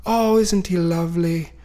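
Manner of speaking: attempted Irish accent. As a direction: have a go at a sweet old Irish lady. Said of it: "I can't do an Irish accent".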